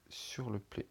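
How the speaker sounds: quiet, unvoiced whisper of "Vous allez sur le Play"; a slip muttered off to the side. Real, not acted. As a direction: whisper the phrase under your breath. Whispers then swears, repeats.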